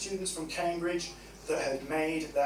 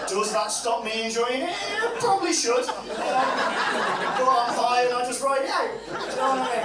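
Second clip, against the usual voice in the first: laughing; 6 semitones higher, 4 dB louder than usual. Real, not acted. Laughing at own confession.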